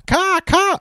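high, loud cawing like a crow; shrill caw, caw. Retold or imitated. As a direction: squawk it out like a bird. Imitating a crow's call.

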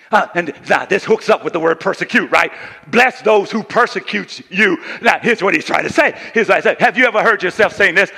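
loud, high, shouted preaching; full-force preaching. Acted. Pastor voicing baffled family members to the church, loud and high. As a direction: thunder at the congregation.

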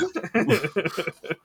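bright burst of laughter; high laugh. Real, not acted. Game master laughing at a player's joke, real high laugh. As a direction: let out a quick high laugh.

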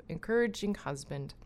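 normal talking voice

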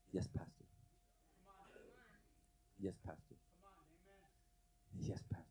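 very soft, low 'yes sir' tone; hushed, defeated obedience; meek submission. Retold or imitated. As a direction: give in quietly, pausing long between answers. Retold submission to his pastor's discipline.

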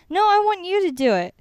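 put-on high, loud, whiny child; toddler voice. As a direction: play the demanding toddler. Voicing demanding toddler.

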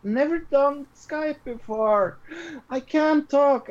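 high, slow, timid imitation; nervous high voice. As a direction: squeak it out like a scared newbie. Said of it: "you were like".

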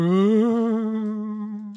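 high drawn-out ghost ooh; ghostly wail. Shown as a demonstration. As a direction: moan it like a haunting ghost. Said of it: "I'm gonna do a ghost sound".

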